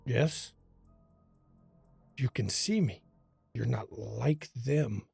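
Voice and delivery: low, raspy whisper of a ghost. Acted. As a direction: rasp it out like a dead man. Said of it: "You hear a raspy voice".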